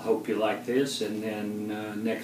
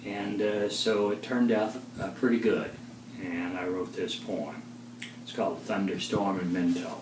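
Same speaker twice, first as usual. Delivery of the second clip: ordinary delivery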